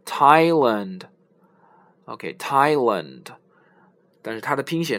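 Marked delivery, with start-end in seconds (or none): 0.0-3.2 Thailand with a plain t; model word. Shown as a demonstration. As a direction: say Thailand slowly with a hard t. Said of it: "类似的还有一个是泰国".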